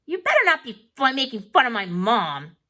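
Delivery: put-on loud kid's threat, not really low; scary warning. A narrator's reading. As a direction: warn them off, half-acted menace. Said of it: "in a low and scary voice".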